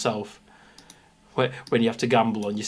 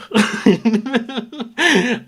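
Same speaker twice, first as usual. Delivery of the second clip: high laugh; 12 semitones higher, 6 dB louder than usual. Real, not acted. Laughing after falsetto bit.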